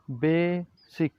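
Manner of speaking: बेसिक drawn out, be-sik, slow and clear; slow pronunciation demo. Shown as a demonstration. Teacher modelling the word slowly. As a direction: say बेसिक slowly so students copy. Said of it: "कैसे pronounce करते हैं".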